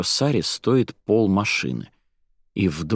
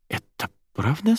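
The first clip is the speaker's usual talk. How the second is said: hesitant, doubting question, rising high at the end; tentative disbelief; doubtful; 9 semitones higher than usual. A narrator's reading. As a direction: ask as if you can't quite believe it. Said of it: "с сомнением спросил Воля".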